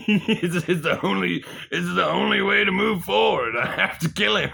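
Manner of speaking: loud, high, laughing shout; hammed-up manic yelling; hysterical conviction. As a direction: shout it as crazed logic. Acted hysterical decision to kill.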